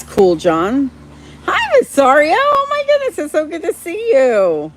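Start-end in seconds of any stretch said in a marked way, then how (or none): 1.4-4.7 much higher, squealing with joy; delighted greeting. Real, not acted. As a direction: greet a dear friend, pitch soaring. Greets a chat regular delightedly.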